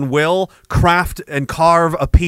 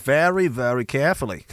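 lower-pitched Australian deadpan; Australian accent; 4 semitones lower, 6 dB quieter than usual. Retold or imitated. Australian soldier answering about field training, deadpan Australian accent. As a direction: imitate a dry Aussie soldier, softer. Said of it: "And they're like".

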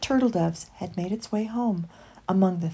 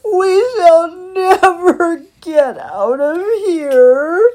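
high, loud, slow wail; long, tearful wail; despairing cry; 9 semitones higher, 12 dB louder, much slower than usual. A narrator's reading. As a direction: bawl it out hopelessly. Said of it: "cried Christopher".

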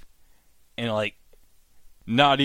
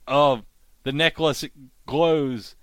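ordinary delivery

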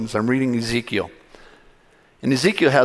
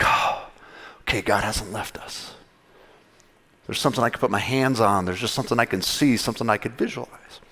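relieved hush; 5 semitones lower, 5 dB quieter than usual. Retold or imitated. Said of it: "it would be like".

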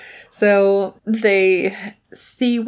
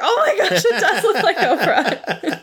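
high, amazed exclamation; 9 semitones higher than usual. Retold or imitated. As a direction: gasp it out in disbelief. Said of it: "I was like".

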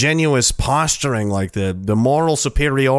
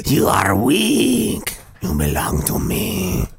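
menacing cat; much slower than usual. Retold or imitated. Cat claiming the fallen man as its own, slow menace. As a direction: savour the victory, deadly slow.

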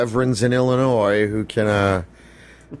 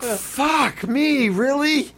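incredulous shout; much slower than usual. Pretend. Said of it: "Be like".

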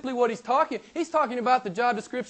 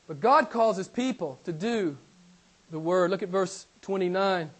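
usual speaking voice

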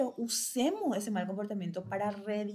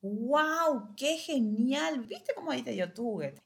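higher and louder, slow delighted wow; enthusiastic praise; 5 semitones higher, 4 dB louder than usual. Shown as a demonstration. Parent praising a child who asked nicely, delighted. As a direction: gush over the child's success. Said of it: "Cuando el chico hace lo que le decimos".

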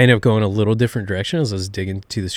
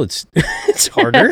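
laughing; 5 dB louder than usual. Real, not acted. Laughing about weekly preaching.

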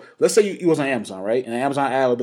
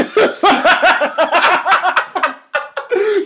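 long, high laughing fit; real high-pitched cackling; helpless laughter; 10 semitones higher than usual. Real, not acted. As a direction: lose it laughing. Cracking up at the thought.

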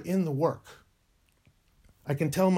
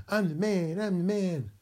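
higher, smug mock swagger; mock cocky bragging; 5 semitones higher than usual. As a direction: strut it like a braggart. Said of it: "They'd be all cocky".

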